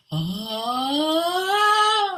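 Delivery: long, high swelling "ah"; imitated open "ah". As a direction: climb and hold a sustained "ah". Imitates woman repeating the exercise.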